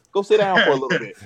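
laughing through the words; amused laugh while telling a kid to sit. Retold or imitated. Laughing while retelling kid tackle.

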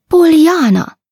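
high, falling cry of the name; alarmed exclamation. A narrator's reading. Lady exclaims the girl's name, alarmed. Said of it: "exclamă doamna Căriu, tremurând".

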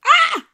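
very high, loud mock scream; sudden shrieked reaction, played for laughs; startled yelp. Acts out own startled reaction.

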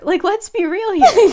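high, giggling aside; laughing. Real, not acted. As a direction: laugh through the line. Laughing at Harry.

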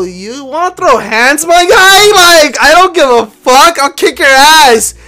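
retold fight challenge. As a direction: call him out, building to a shout.

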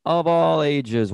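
booming showman announcer voice; ringmaster. Pretend. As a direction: circus ringmaster intro. Podcast opening announcer flourish.

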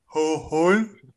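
slow mocking repeat of Ho-, holen; teasing echo. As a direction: drag out Ho-, holen as a tease. Echoes friend's stumble teasingly.

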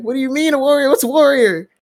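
very high, baffled squawk; retold incredulity. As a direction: demand an explanation in utter mock disbelief. Retold bafflement at an odd insult.

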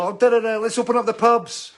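high, loud sneering mimicry of careless people; mock them, high and loud; jeering caricature of pub fans. Mocking pub-reopening crowd.

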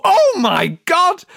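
high, loud, drawn-out mock awe; hammed-up thrilled cry; mock amazement. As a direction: gush slowly like an overjoyed listener. Acted thrilled listener finding podcast.

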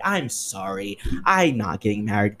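normal talking voice

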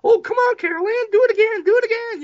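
very high mock cooing mother; amused mom. Mother urging daughter to repeat trick, high squealing imitation. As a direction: squeal it like a thrilled mom egging on her kid. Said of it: "And she's just kinda like".

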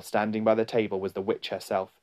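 ordinary delivery

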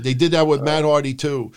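usual speaking voice

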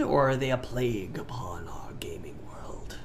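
hushed, sinister stage whisper; mock-dramatic whispered dread; ominous whisper. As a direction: whisper it like a dire warning. Mock-ominous intro to the topic.

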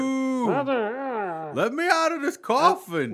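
muffled coffin ghost. Pretend. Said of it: "It's like muffled dirt".

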